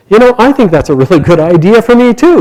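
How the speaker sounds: high, animated burst of enthusiasm; eager agreement. A narrator's reading. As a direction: jump at the idea, pitched well up. Bhishma jumps at leaving the world.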